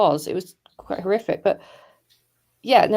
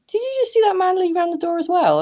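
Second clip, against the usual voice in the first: put-on child's voice; 13 semitones higher, 3 dB louder than usual. Son's startled question retold.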